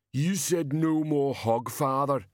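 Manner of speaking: big simple man, dragging out each word; slow-witted giant. A narrator's reading. Slow giant Banjo challenges Teatime.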